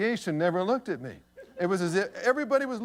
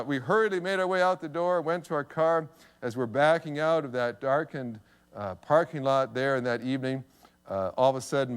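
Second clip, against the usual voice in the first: ordinary delivery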